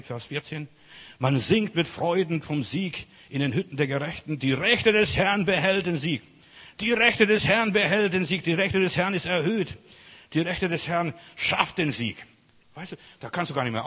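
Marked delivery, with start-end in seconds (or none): 1.2-12.3 loud, higher, ringing proclamation; full-force preaching. Real, not acted. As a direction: hammer out the victory verse to the congregation.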